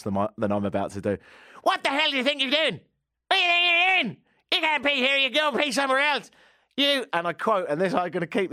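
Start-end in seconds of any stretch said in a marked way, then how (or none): 1.6-7.1 high, loud put-on Irish accent, ranting. Homeless man yelling at the peeing speaker, shouting in an Irish accent. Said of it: "he was a little Irish fella".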